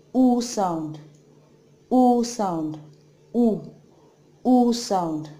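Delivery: rounded "U" said clearly; Korean vowel U. Shown as a demonstration. Teacher drilling "U" for learners. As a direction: model "U" slowly with round lips. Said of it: "This is".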